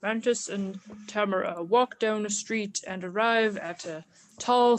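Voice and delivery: ordinary delivery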